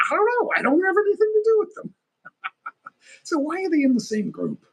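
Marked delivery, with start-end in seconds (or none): 0.1-1.9 high, loud, rushed put-on protest. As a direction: blurt it out in a pitched-up caricature.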